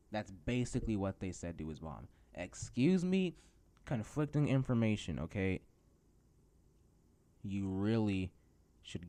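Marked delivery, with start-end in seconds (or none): 2.3-5.6 high, loud mock indignation; affronted, louder and higher; indignant. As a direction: call them out, pitch jumping up. Indignant at contradictory hiring message.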